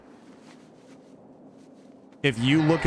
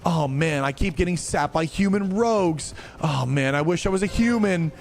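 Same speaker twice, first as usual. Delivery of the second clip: higher, whining mock complaint; whiny player; 5 semitones higher than usual. Streamer mocking a player who keeps rerolling, higher whiny mock voice. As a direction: whine like a gamer who always wants the other race. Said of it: "and guess what happens?".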